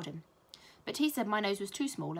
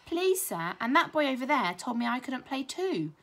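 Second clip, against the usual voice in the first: whiny tattling; 6 semitones higher, 6 dB louder than usual. A narrator's reading. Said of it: "added Brendan the bully".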